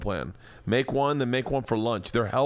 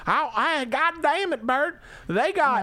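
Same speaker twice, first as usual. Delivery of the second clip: hammed-up Southern accent; slower than usual. Host mocks wife reading meal plans in a Southern accent. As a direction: lay the Southern drawl on thick as the wife. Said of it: "I wish I hadn't done it in that accent".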